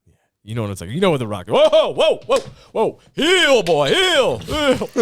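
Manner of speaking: loud, high wrestling showman; The Rock. Acted. Comic as heel wrestler addressing the ladies, loud showboating. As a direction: work the crowd as a booming heel wrestler. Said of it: "a little bit more Hollywood Rock, where he was a heel".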